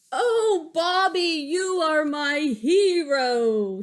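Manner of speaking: high, slow swooning teacher voice; gushing, drawn-out thanks; Miss Kirby voice. Acted. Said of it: "she says".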